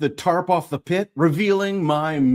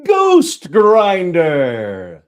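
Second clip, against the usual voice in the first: proud loud unveiling; 8 dB louder, much slower than usual. Acted. Unveiling the trap's name.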